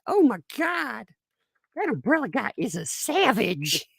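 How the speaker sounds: high, amazed mock gush; mock amazed fan. Streamer imagining viewers gasping at his savagery, in a high mock gush. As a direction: gasp it like a starstruck fan.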